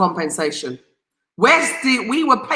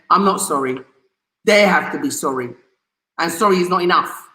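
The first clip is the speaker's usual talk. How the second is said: ordinary talk, nothing marked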